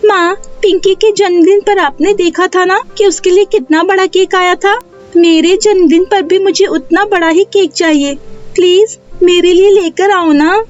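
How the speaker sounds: put-on high-pitched child's voice, coaxing; pleading young daughter. Neha asks her mother for a big cake, pleading high child voice. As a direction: beg like a little girl, pitched well up.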